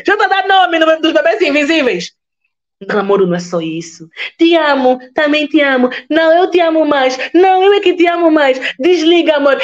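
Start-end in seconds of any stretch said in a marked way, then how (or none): 0.0-2.1 laughing. Real, not acted. Laughing at imagined named babies. 4.4-9.6 higher, sugary lovers' phone talk; giggly late night call. Pretend.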